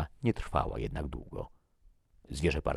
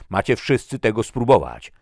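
loud, high, threatening order; menacing raised voice; aggressive demand; 7 semitones higher, 13 dB louder than usual. A narrator's reading. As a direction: force it on everyone. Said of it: "Ingeborn stał się agresywny".

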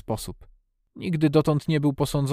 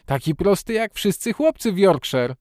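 high, proud cry; exclaiming; 7 semitones higher, 5 dB louder than usual. A narrator's reading. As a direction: call it out excitedly. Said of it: "zawołał Dick".

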